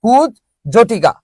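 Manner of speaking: কুজ জটিকা slowly, long gap after kuj; split pronunciation. Shown as a demonstration. Teacher answering own quiz, কুজ জটিকা slowly split in two. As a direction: hold apart কুজ জটিকা as kuj... jhotika. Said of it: "তাহলে কি হবে".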